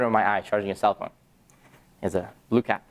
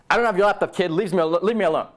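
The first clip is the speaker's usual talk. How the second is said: higher, irritated push-back; annoyed denial; 7 semitones higher than usual. Retold or imitated. Thief snapping at the pestering kid, irritated.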